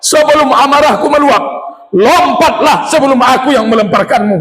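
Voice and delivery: high, raging threat; angry Pharaoh. Retold or imitated. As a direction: threaten her before the fury boils over. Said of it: "marah Fir'aun".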